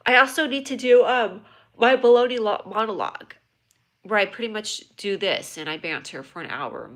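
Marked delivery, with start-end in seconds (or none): 0.1-3.3 louder silly voice; put-on voice. Put-on voice again.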